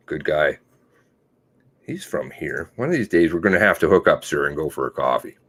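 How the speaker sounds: ordinary delivery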